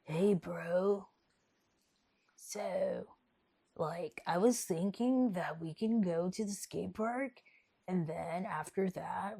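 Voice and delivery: slow skater-dude voice; put-on laid-back skater, words stretched out; Seth. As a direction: drawl like a gnarly skateboarder bro. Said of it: "this is how Seth talks".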